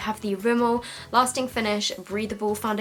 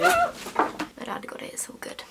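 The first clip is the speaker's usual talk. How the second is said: relieved whisper; slower than usual. Real, not acted. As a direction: whisper it, still jittery from the spider. Spider gone, still shaken.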